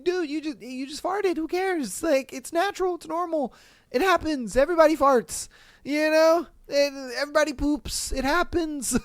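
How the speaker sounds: high-pitched, incredulous acted reassurance; reassuring boyfriend. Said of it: "I would be standing there as the guy".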